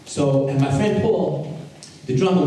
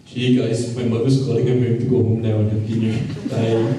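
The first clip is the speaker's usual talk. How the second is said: tag; 3 semitones lower than usual. Retold or imitated. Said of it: "And I'm like".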